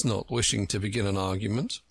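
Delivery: plain reading voice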